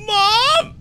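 high, wailing fake sob; fake crying voice. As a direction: pretend to cry for mom, high and broken. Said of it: "does this crying voice sound believable".

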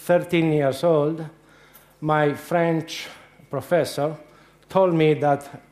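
usual speaking voice

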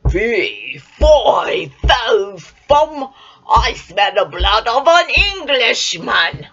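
loud booming giant chant; giant's roar. Acted. Giant sniffing out Jack, loud bellowing chant.

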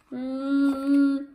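plain reading voice

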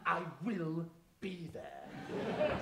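slow drawn-out menace; sinister. Acted. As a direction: stretch it out like a villain's promise. Sinister threat to Fiona.

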